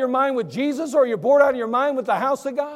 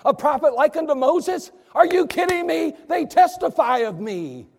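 higher, insistent rhetorical questions; incredulous challenge; 5 semitones higher than usual. Acted. Preacher as Jesus confronting religious leaders, incredulous challenge. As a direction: press them in mounting disbelief.

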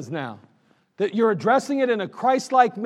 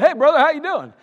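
cheery greeting; 7 semitones higher, 6 dB louder than usual. Retold or imitated.

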